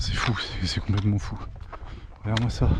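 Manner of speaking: a soft whisper of wonder; awed whisper. Real, not acted. Hiker marvelling at remote houses, softly whispered awe. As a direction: breathe it out, amazed by the view.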